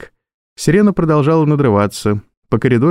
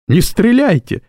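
desperate scream; 10 semitones higher than usual. Acted. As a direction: shout it in pure terror. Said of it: "в отчаянии закричал Джо".

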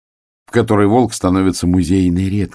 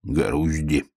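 pouting; 3 semitones lower, 6 dB quieter than usual. A narrator's reading. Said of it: "оттопырив губу".

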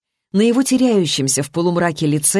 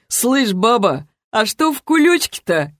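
high-pitched leering taunt; lewd grin; 5 semitones higher than usual. A narrator's reading. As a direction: tease with a dirty grin. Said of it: "с похабной улыбочкой поинтересовался". (похабная издёвка высоким голосом)

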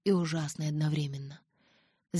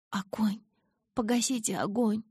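high pained cry without much volume; mock wail; 5 semitones higher than usual. A narrator's reading. Injured girl begging them to douse fire, wailing. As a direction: wail it in agony, restrained. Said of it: "вопила я".

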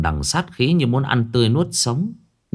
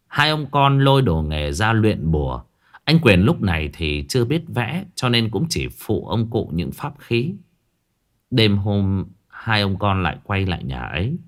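plain reading voice

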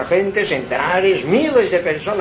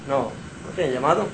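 low, soft, quick reply; father answering; 5 semitones lower, 7 dB quieter, much faster than usual. Retold or imitated. The old priest answering Samuel, low, soft and quick. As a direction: brush the boy off gently and low.

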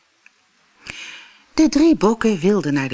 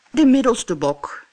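middle goat; 6 semitones higher, 5 dB louder than usual. Acted. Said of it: "zei de bok".

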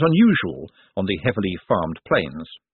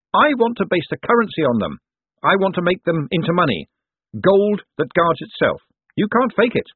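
high, projected pitch to a crowd; showman Moist, declaiming; 9 semitones higher, 3 dB louder than usual. A narrator's reading. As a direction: proclaim it grandly to the onlookers.

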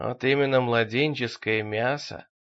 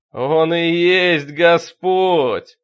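very drawn-out, loud, higher cry; fanatical proclamation; 4 semitones higher, 10 dB louder, much slower than usual. A narrator's reading. Deacon proclaims his master as Lord, in a fanatical shout. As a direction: proclaim it with every word stretched.

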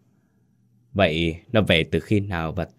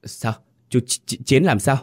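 stunned question; 6 semitones higher than usual. Acted. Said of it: "ngơ ngác hỏi".

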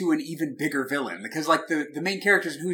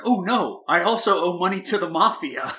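mock dismay; 6 semitones higher, 4 dB louder than usual. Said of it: "John Leguizamo is like".

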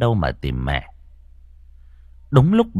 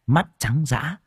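ordinary delivery, nothing marked

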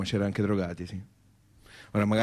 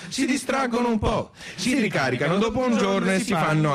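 shout; 8 semitones higher than usual. Acted. Shouted comic peak in repeated joke.

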